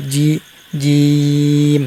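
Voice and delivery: জি with the mid tongue against the palate; drawn-out জিম from the palate; Arabic jim. Shown as a demonstration. Teacher demonstrates Arabic jim to students. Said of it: "উপরে তালুর সাথে লাগিয়ে জি".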